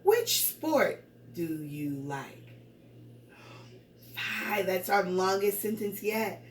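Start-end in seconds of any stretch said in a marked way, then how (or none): 0.0-2.4 slow, evenly separated words; counting words. Shown as a demonstration. Teacher counting words with pupils, slow word-by-word. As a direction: say one word per count, slowly. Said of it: "All right, let's count".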